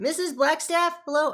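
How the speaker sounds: high-pitched, questioning call across a room; calling for attention. Acted. Character hails Missus Blackstaff from the table, calling out. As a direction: call out to someone ignoring you. Waving to get her attention.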